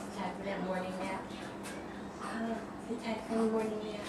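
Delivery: very soft, low, quick murmur; hushed soothing. Real, not acted. Parent settling baby for morning nap, hushed murmur.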